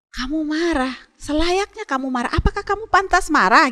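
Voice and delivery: a hint of a probing, patient God; God questioning. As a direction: ask it like God gently testing Jonah. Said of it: "Tuhan Allah bilang begini".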